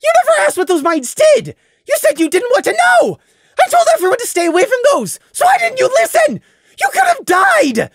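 high, loud furious yelling; angry shout. Acted. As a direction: berate them at full volume. Prey yelling at Crimson.

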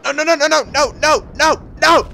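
high, loud string of panicked no's; panicked shouting. Real, not acted. Gamer shouting at the mine blast, loud and panicked. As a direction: yell it as the explosion hits.

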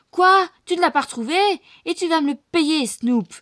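very loud, high raging yell; furious scream. A narrator's reading. Médusa screams at Snoop for losing the girl, very loud. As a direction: roar at him in fury. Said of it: "en hurlant".

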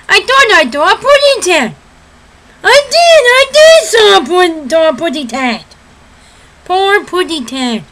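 high, baby-talking cartoon canary; Tweety Bird. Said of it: "Tweety Bird".

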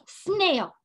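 "Snail" as one high, falling beat; one-syllable beat. Shown as a demonstration. Teacher jumping with the class, "Snail" as one beat. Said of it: "Can you do it again?".

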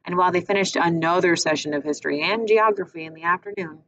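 ordinary delivery

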